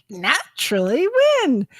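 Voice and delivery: drawn-up questioning disbelief; mildly exasperated retold disbelief; incredulous echo. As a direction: throw the word back as a question. She echoes doctor in disbelief.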